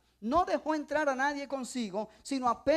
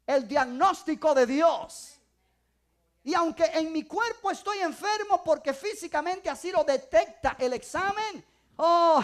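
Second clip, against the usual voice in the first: louder, emphatic preaching; full-force preaching; 5 dB louder than usual. Acted. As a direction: drive the point home to the church.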